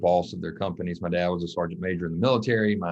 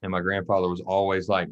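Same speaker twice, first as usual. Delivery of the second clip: usual speaking voice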